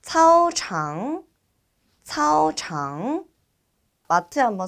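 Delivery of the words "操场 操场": slow 차오장, high level then dipping and rising; repeat-after-me model. Shown as a demonstration. Teacher leading students to read 차오장, slow high model. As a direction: stretch 차오장 into two clear tones. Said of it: "따라서 한번 읽어보도록".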